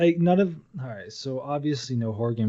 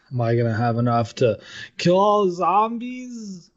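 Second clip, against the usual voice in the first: mock panic; 8 semitones higher than usual. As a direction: worry aloud, pitch climbing on the last words. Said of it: "you just like".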